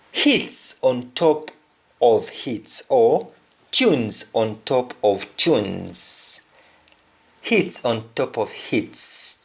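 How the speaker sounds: slow, spelled-out "Hits on top of hits"; teacher slowing down to explain; slow explanation. Shown as a demonstration. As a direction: lay it out slowly word by word. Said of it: "we sometimes call them tune".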